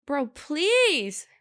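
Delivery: mock-desperate, stretched-out please; exasperated plea. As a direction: beg a noisy moviegoer to stop. Pleading with noisy snack eater.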